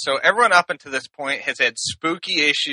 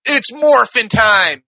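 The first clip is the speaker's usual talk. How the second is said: shout; 5 semitones higher, slower than usual. Retold or imitated. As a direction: yell it like a hero transforming. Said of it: "know what Dante says?".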